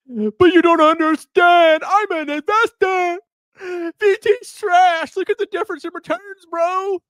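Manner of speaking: high, whiny mock investor voice; mock bull investor. Host parodying investors defending the stock, hammed-up. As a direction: ham up a smug retail bro. Mocking a bullish investor's retort.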